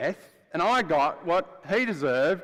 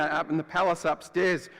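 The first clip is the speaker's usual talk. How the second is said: ordinary delivery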